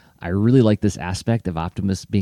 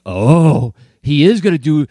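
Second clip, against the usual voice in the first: loud, higher mock gasp of discovery; kid's alarmed realization; 5 semitones higher, 8 dB louder than usual. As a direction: exclaim like a kid who just figured it out. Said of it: "I remember that feeling like".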